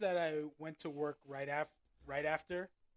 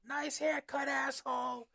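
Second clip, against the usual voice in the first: higher mock jeer; heckling old fan; 9 semitones higher, 4 dB louder, slower than usual. Old Yankee fan heckling a Red Sox fan, mock jeer. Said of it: "this one old guy's goes".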